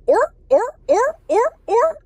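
loud, high yelps of a seal; seal barking. Acted. Adult inviting a child to copy, loud seal barks. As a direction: honk out short rising seal barks. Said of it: "Can you make that sound?".

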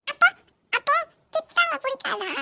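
put-on high stumbling little girl voice; child voice. Niece explaining dust in her eye, high childish. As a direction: stammer like a small child.

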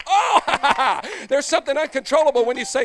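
loud, high, laughing preacher; carried away by the spirit; shouting preacher full of joy; ecstatic preaching. Real, not acted.

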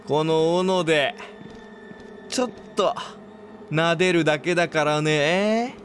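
very slow, falsely gentle threat; creepy axe killer. Pretend. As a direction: pretend kindness that hides menace. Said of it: "みたいなこと？". (殺人鬼が優しげに見せかけて脅す)

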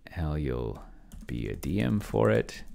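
ordinary talk, nothing marked

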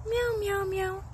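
high, soft run of meows; playful soft kitty meows; cat imitation. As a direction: meow gently up high. Meowing to the visiting cat.

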